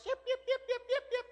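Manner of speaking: high, rapid little yips; squeaky repeated dog yelps, far above normal pitch; yappy dog. Acted. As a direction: imitate a small yappy dog. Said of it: "when that dog goes".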